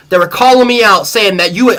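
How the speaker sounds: ordinary talk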